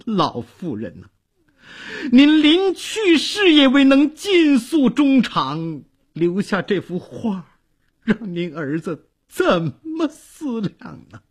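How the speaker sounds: high-pitched tearful wail, easing into sobbing; acted weeping lament; crying while lamenting. A narrator's reading. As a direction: pour out grief through tears. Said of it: "边哭边诉说".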